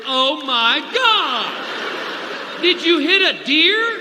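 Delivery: long, stretched cry of disgust; slow, appalled comic wail; wife's mock scream. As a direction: draw out every word in horrified protest. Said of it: "get your wife to scream".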